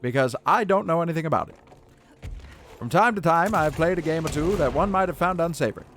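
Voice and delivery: lightly voiced vintage radio host; old radio announcer. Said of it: "old-timey radio announcer, I can do".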